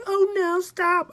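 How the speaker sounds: put-on high, loud whining child; whiny little boy. A grown man voicing a whining boy at the gate. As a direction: wail like a small kid refusing.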